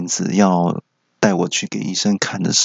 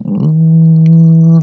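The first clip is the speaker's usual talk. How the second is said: long loud high mechanical hum; imitating the dental chair's motor; machine hum; 8 semitones higher, 8 dB louder than usual. Shown as a demonstration. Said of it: "自己配这个机器运转的声音".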